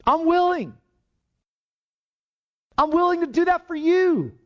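high, tender voice of Jesus offering himself; Jesus voiced. Jesus tells the leper he is willing, tender and high. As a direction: speak as Jesus, gently willing to heal.

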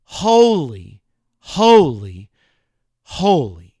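a touch of angelic proclamation; angelic cry. Retold or imitated. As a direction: sing out holy three times. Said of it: "what was the one thing they wanted to say".

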